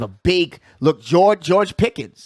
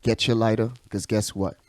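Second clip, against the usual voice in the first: low radio bumper voice; 7 semitones lower, 5 dB quieter than usual. Acted. Host announcing the smoke break, low announcer voice. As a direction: lay it down like a late-night radio ad.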